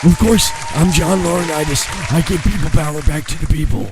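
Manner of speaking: high, strained executive impression; John Laurinaitis. Co-host obliging with the executive's slogan, high and strained. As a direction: squeeze out a croaky, high-pitched announcement. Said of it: "wanna hear some Johnny?".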